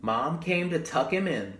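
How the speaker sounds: usual speaking voice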